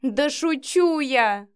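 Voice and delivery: high, loud, drawn-out amusement; gleeful outburst; exclaiming through laughter. A narrator's reading. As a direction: cry it out, barely holding back laughter. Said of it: "воскликнула Вика, не сдерживая смех".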